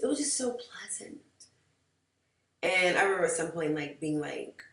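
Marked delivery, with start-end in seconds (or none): 0.0-1.2 breathy whisper, savoring the memory. Retold or imitated. Remembering a lovely date night, whispered. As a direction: hush it like a happy secret. Dreamy memory of a pleasant evening.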